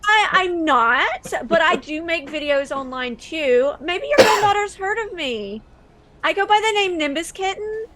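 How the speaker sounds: lightly voiced internet personality; a hint of gushing video-maker sweetness; sweet online star. Streamer winning over a grandmother at the door, lightly gushing.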